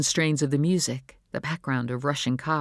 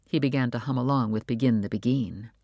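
ordinary delivery, nothing marked

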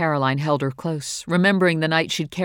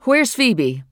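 loud, higher anxious question; urgent question; 7 semitones higher, 6 dB louder than usual. Acted. Mother asks the girl where her daughter is, loud and anxious. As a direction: demand an answer fast.

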